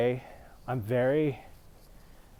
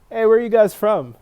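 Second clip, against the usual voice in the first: loud, higher call across the room; 9 semitones higher, 13 dB louder than usual. Retold or imitated. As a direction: hail them from a few seats away. Calling out to nearby guys.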